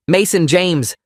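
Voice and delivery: angry father scolding his son; stern full-name call. Acted.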